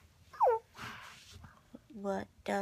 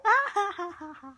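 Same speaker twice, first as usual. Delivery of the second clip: higher, louder ha ha laugh; 11 semitones higher, 7 dB louder, faster than usual. Real, not acted. Parent laughing with the tickling baby, louder higher laugh. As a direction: laugh out with delight.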